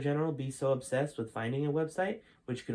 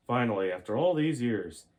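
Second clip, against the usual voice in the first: wrong general voice; 5 dB louder than usual. Acted. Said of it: "that's not his voice".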